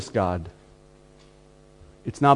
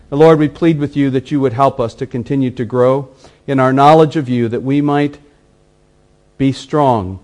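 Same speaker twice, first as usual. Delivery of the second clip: closing prayer; 5 dB louder than usual. Acted.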